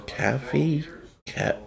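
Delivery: tentative questioning "Caffee", first vowel like cat; trying others' pronunciation. Shown as a demonstration. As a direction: try out "Caffee" as a foreign-sounding guess. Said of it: "How do other people say coffee?".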